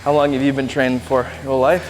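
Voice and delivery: ordinary delivery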